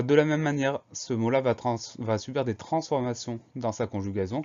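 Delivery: normal talking voice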